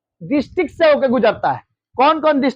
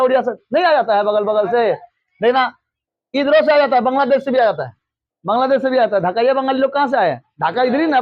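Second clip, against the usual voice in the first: ordinary delivery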